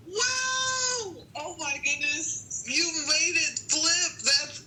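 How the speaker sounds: high delighted gasp of wonder; surprised wow. Real, not acted. Gasps at story twist.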